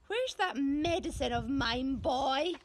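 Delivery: grumpy grandma shouting; calling granny. A narrator's reading. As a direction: call out from another room, impatient. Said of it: "came a voice from the living room".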